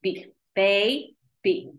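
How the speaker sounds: put-on slow B, baby, each syllable drawn out; slow syllable blend. Shown as a demonstration. Teacher blending baby slowly for the student. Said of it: "Now say it as we write it".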